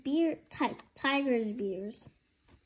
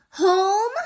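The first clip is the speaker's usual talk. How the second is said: high, loud startled home; startled question; 6 semitones higher, 6 dB louder than usual. Acted. Tig startled to find someone in his home, high question. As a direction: jump at the word.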